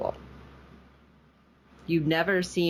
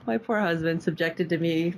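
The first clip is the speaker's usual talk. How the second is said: normal talking voice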